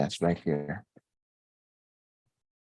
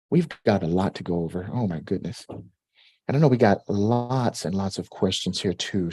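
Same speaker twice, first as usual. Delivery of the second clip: ordinary talk, nothing marked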